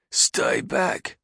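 high, breathy gruff guard. Acted. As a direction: bark it through the door. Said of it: "a gruff voice said".

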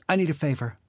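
put-on high, sweet, coaxing party girl. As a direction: bat your lashes with the words. Marley asking Liam a favor.

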